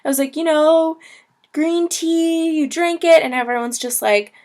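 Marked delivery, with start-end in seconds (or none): 0.3-3.2 eager explaining. Retold or imitated. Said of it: "pantomiming in Chinese".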